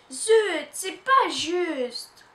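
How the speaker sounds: frustrated outburst. Acted. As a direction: stamp your foot in words. Child protests being left out.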